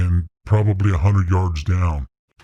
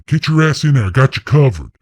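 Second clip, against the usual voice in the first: retold scream; 6 semitones higher than usual.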